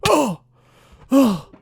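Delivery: sharp high surprised yelps; jolted, cry out each time; startled oh. Real, not acted. Player startled by the chest plate, high yelps.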